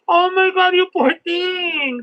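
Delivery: oh my God, so slow and aching; sympathetic gasp. Real, not acted. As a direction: gasp in real sympathy, stretch the words. Sympathy for reader's injury.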